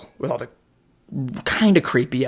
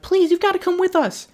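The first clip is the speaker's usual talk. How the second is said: mock high pleading; 3 dB louder than usual. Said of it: "they're like".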